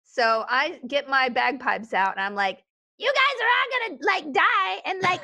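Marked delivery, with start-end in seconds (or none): 3.0-5.2 lightly acted, higher-pitched character voice; bagpiper in character. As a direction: announce it to the doomed crew, pitched up. Acted quote to doomed shipmates.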